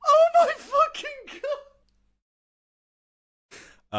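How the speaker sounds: real stunned squeal of dismay; horrified disbelief. Real, not acted. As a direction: react aghast, stretching each word high. Listener reacts to shocking quote.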